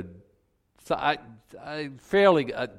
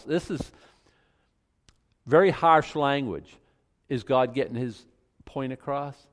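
normal talking voice

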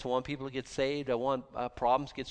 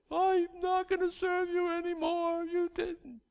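mock whiny believer; slower than usual. Mocking a sulking believer.

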